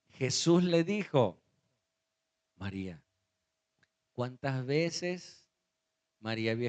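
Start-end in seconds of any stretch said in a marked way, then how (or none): 2.6-3.0 low, soft calling of a name; special, recognisable voice. Acted. Jesus calls Mary by name at the tomb, softly. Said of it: "con esa voz".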